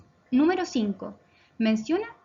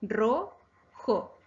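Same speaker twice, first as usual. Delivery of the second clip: "Ro-jo" drawn out with a gap between syllables; teacher dividing "Ro-jo" into syllables. Shown as a demonstration. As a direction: split "Ro-jo" into two separate beats. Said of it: "Se divide en dos sílabas".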